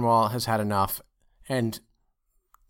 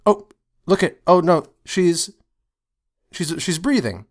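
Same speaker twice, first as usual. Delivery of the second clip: a touch of Lear's dying hope, higher and louder; acted Lear; 4 semitones higher, 5 dB louder than usual. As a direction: lightly voice the dying king seeing Cordelia breathe.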